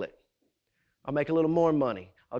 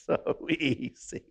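smug Satan; 2 semitones lower, slower than usual. Acted. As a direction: let Satan gloat, easy and lazy. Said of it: "And Satan just sits back and goes".

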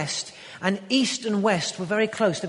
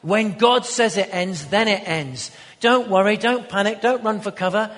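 full-force preaching; 6 dB louder than usual. Acted.

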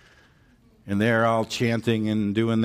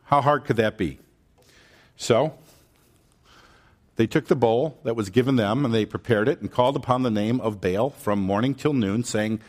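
normal talking voice

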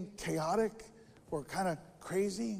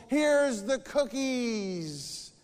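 drawn-out call, very slow and higher; 6 semitones higher, much slower than usual. Acted. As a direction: offer it up to the birds, stretched. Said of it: "he calls".

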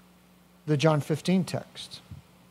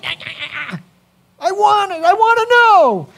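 high, loud, stretched-out yearning, climbing higher; eager longing; 13 semitones higher, 7 dB louder, much slower than usual. Acted. Peter longing to follow Jesus, high loud yearning cry. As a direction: ache with wanting, pitch soaring. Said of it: "you can almost hear that in Peter's voice".

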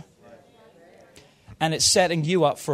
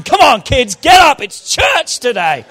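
put-on exasperated shout to rouse the kids; loud, high, grudging wake-up yell; fed-up parent; 6 semitones higher, 10 dB louder than usual. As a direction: holler at them like church is a chore. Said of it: "you wonder why your kids aren't excited?".

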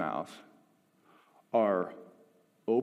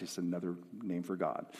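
ordinary talk, nothing marked

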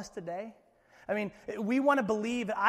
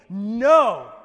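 a booming, much louder no; emphatic no; 14 dB louder than usual. Retold or imitated. Jesus refusing Satan, a loud emphatic no. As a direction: slam the word down with full force. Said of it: "this emphatic heavenly".

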